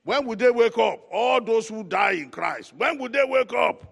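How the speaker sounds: high, very loud call to the congregation; preacher's shouted question. Real, not acted. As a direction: fire the question out at full voice, drawing out the last words.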